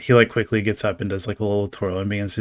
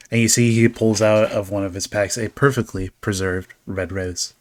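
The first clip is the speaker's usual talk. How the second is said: ordinary talk, nothing marked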